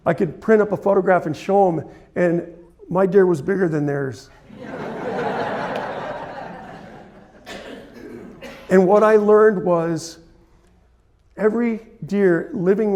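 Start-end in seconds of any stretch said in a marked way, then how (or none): 4.6-6.8 soft, unvoiced chuckle; a quiet breathy laugh. Real, not acted. Chuckling to the audience after bragging about his deer.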